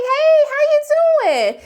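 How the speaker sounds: high, loud, over-friendly hello; chipper greeting. Retold or imitated. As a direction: imitate someone being far too perky. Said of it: "why you being all chipper".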